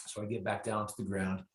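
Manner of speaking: ordinary talk, nothing marked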